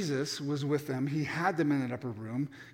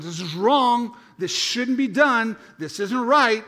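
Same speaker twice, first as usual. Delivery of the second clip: indignant Peter; 5 semitones higher, 7 dB louder, slower than usual. Acted.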